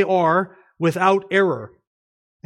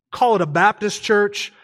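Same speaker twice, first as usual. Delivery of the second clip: normal talking voice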